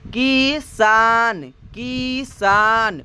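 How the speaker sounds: किसान very slowly, as कीइ-साान; slow dictation of किसान, each vowel held; syllable-by-syllable demo. Shown as a demonstration. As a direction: stretch both syllables of किसान for learners. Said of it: "जैसे कि किसान लिखना है".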